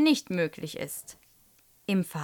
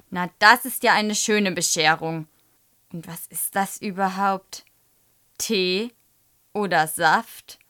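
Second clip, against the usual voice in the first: irritated teacher's questioning; emphatic, with a touch of annoyance; annoyed; 5 dB louder than usual. A narrator's reading. Teacher inspects the wet exercise book, annoyed. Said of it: "sagt Frau Schröder ärgerlich".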